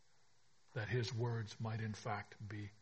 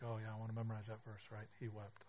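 mock casual; 9 dB quieter, faster than usual. Mocking casual attitude to verse.

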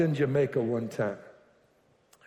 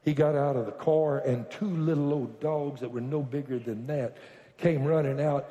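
normal talking voice

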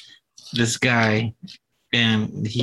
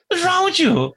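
very high, squeaky disbelief; mock shocked outrage; 3 dB louder than usual. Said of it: "I'm like".

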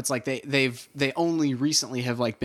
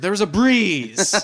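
slower, louder and higher, like a lame excuse; mock lame excuse; 5 semitones higher, 5 dB louder, slower than usual. Oliver answering Slade after missing, slow mock excuse. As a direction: drawl it out as a feeble alibi.

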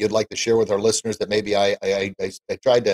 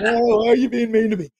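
very high, whiny mock child voice; whiny kid; 14 semitones higher, 4 dB louder than usual. Teased boy complaining to the kids who mock him, high mock whine. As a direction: whine like a picked-on little kid. Mocking teasing kids' target.

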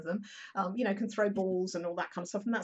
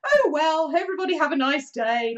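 high, loud, breezy sing-song goodbye; brightly bail out of the awkward scene; forced cheerful exit; 7 semitones higher, 9 dB louder than usual. Retold or imitated. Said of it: "I have to sort of go".

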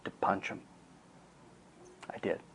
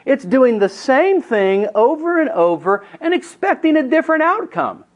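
preaching emphasis; 11 semitones higher, 4 dB louder than usual. Acted. Preaching definition of insanity.

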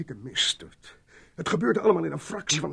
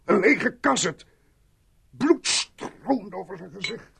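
slow, louder, higher horrified recall; 6 semitones higher, 8 dB louder, slower than usual. Acted. Mitchell describes the dead man's face to the sergeant, aghast. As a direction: force each word out as if seeing it again.

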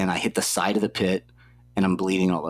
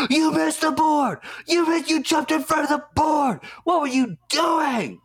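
coach yelling. Retold or imitated. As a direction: bark at the athlete who missed the board.

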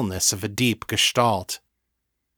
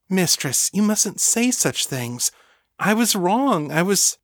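high, flustered protest; distressed devotee refusing praise; abashed servant; 9 semitones higher than usual. Acted. Lena abashed, protests mistress's praise.

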